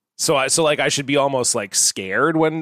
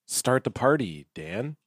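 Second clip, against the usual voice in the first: softer, with less breath than the first try; slow creeping dread; 3 semitones lower, 6 dB quieter, slower than usual. Shown as a demonstration. Host's second take at the opener, leaning into creeping dread, quietly. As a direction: hold back the breath and let the dread sit low. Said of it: "yeah, just lean in".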